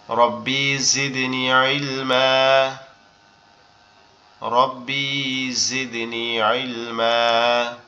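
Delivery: slow, drawn-out Quranic chant; prayer recitation. Acted. Teacher opens Quran class with supplications, slow melodic recitation. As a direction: recite the prayer with long held vowels.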